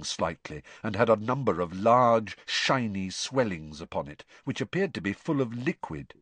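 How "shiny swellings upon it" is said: ordinary delivery, nothing marked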